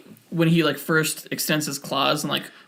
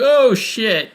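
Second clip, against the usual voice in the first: shocked exclamation; 8 semitones higher, 11 dB louder than usual. Retold or imitated. Reacting to violent opening scene.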